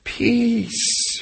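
high, soft easygoing calm; laid-back steward. Pretend. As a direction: tell them to relax, unbothered. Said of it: "the steward just goes".

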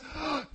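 startled intake of breath; sharp gasp. Acted. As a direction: gasp as if overwhelmed. Jacob acted before kissing.

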